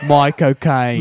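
slow, drawn-out Cockney accent; Cockney MY COCAINE, sounding like the actor's name; Michael Caine impression. Shown as a demonstration. Showing a friend the trick phrase, Cockney accent. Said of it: "it's Michael Caine".

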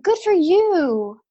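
high, slow, patronizing "good for you"; condescending pity; pity compliment. Retold or imitated. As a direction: sound sweetly condescending. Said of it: "she's like".

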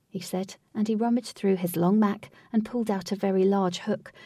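ordinary delivery, nothing marked

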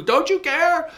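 high, loud desperate plea; pleading shout. Acted. Cartoon man begging Jesus to wake, high shouted plea.

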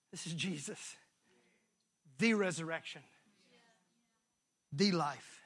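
low, soft, reverent reveal; hushed awe. Real, not acted. Preacher revealing who Jesus is, in hushed awe. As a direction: let it fall into awe. Climactic revelation, softened.

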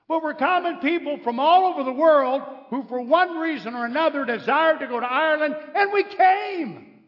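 high, loud preacher's proclamation; rousing climax. Real, not acted. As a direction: proclaim it to the whole crowd.

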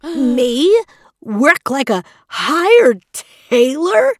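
high, slow, outraged disbelief; shocked demand. A narrator's reading. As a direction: dressmaker appalled at sewing himself. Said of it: "the dressmaker demanded in shock".

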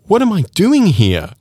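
high-pitched, with "doing here" punched up; emphatic question. Shown as a demonstration. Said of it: "if you emphasize the word là".

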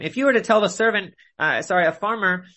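normal talking voice

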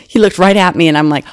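ordinary talk

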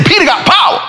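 high, punched-up amazement; impressed exclamation. Retold or imitated. Visitor marvels at his friend's pull, high and emphatic. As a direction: lift POWER high in admiration. Repeats it louder and higher for effect.

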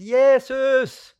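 loud, high call for help; yelling out for rescue under the pier; desperate cry. Retold or imitated. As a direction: cry out his name from the dark water. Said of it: "Og jeg ropte ut".

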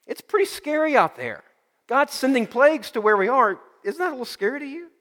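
high, anxious voice rising on scary; nervous friend. Acted.

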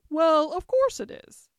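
high, loud matter-of-fact queen; haughty queen. A narrator's reading. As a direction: answer as an imperious queen stating the obvious. Queen answers puzzled Alice haughtily.

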